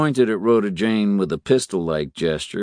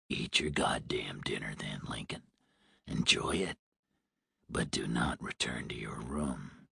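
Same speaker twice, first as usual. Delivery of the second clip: low hoarse smoker's whisper; 10 semitones lower, 14 dB quieter than usual. A narrator's reading. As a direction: threaten in a rasping whisper. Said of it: "The man sounded hoarse, like a heavy smoker".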